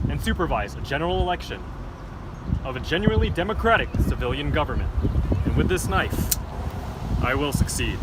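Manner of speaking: loud commanding dictator. Acted. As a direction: proclaim it like a tyrant. Dictator speech.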